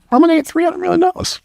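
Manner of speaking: very high, fast squeaky doubting voice; little squeaky voice. Retold or imitated. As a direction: squeak it out like a small scared brain. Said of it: "little squeaky voice".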